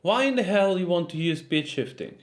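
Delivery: ordinary talk, nothing marked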